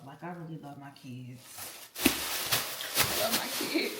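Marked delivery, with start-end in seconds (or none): 2.0-4.0 slow tearful near-whisper; emotional, voice breaking. Real, not acted. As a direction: let the tears catch the words. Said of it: "I get so emotional".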